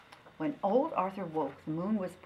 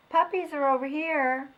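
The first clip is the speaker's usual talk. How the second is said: higher, louder calling voice; mother calling; 8 semitones higher, 8 dB louder than usual. Acted. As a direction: wave him over to the puppies. Mother calling her son.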